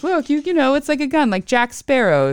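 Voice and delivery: high-pitched mock pleading explanation; imitating husband explaining.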